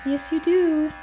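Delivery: high sing-song scolding; high pet talk. Real, not acted. Groomer teasing the dog about pine needles, high sing-song.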